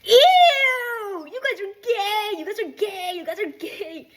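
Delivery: put-on very high kid-brother voice, drawn out; little brother taunting. Little brother walking in on the couple, high mocking chant. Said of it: "my little brother walks in".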